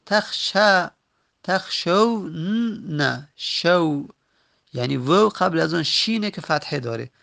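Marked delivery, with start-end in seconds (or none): 0.1-4.1 drawn-out demo. Shown as a demonstration. Teacher drawing out verb form.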